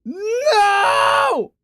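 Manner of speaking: high furious yell at the bad news; angry shout. Real, not acted. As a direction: scream it out in real frustration. Food delivered to wrong house.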